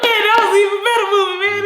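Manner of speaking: very high, loud and fast; raving praise. Acted. Crowd greeting you after dying again, raving praise. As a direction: squeal it out like an ecstatic fan. Crowd cheers the next life.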